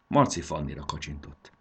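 ordinary delivery, nothing marked

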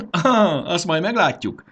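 high, loud amused retort; laughing. A narrator's reading. Marci answers the fairy girl's doubt, laughing. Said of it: "nevetett Marci".

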